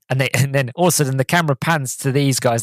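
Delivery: normal talking voice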